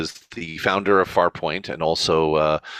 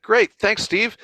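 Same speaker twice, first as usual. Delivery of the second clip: eager thanks; 14 semitones higher, slower than usual. Retold or imitated. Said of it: "I was like".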